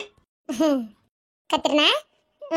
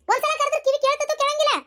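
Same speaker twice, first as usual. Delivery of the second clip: annoyed call; 9 semitones higher than usual. Acted. Wife scolding husband who ignores.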